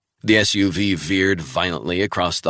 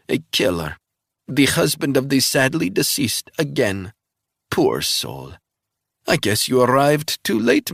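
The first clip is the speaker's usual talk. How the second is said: put-on high, loud Russian-accented gloating; Russian agent mocking the CIA man; taunting Russian spy; 8 semitones higher, 4 dB louder than usual. A narrator's reading. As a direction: savour every insult, gloating. Russian villainess held voice.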